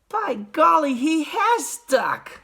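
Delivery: put-on high, drawn-out boy's voice; amazed boy; Charlie. A narrator's reading. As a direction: exclaim slowly in a child's pitch. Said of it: "said Charlie".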